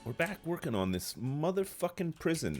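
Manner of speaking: ordinary talk, nothing marked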